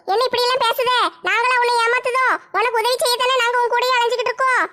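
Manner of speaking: ordinary talk, nothing marked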